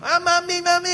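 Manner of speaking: put-on high whining child crying for mami; whiny tantrum child. Preacher imitating the neighbor's child throwing a tantrum, high mock whine. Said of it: "nagtatantrum na lang".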